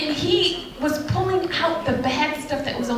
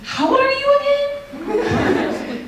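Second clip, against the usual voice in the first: much higher, slow, drawn-out disbelief with a laugh; incredulous sass; 13 semitones higher, much slower than usual. Retold or imitated. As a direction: ask it like he's acting like an old man. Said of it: "And I'm thinking".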